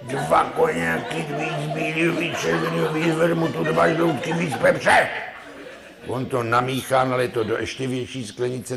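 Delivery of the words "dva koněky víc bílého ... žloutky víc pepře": put-on lightly higher, excited drunk rattling off an order; a drunk keen to get the same brew, laughing; eager drunk. Drunk orders a cocktail from the barman, lightly excited.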